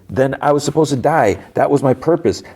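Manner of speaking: high-pitched imitated outburst; retold indignant protest, no shouting; anguished protest.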